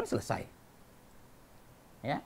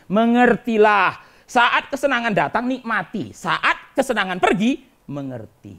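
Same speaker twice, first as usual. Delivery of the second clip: loud, slow, raised sermon proclamation; forceful preaching; 6 semitones higher, 9 dB louder, slower than usual. Real, not acted. Preacher urging the congregation to accept good and bad times, forceful preaching. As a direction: drive each phrase home to the congregation. Preacher drives home his maxim.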